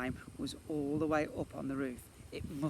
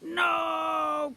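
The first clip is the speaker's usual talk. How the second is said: long, high, loud protest; crying out; 8 dB louder than usual. A narrator's reading. Twin watching shop raided, high loud cry. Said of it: "cried Edmund".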